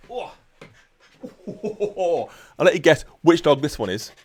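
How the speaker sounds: usual speaking voice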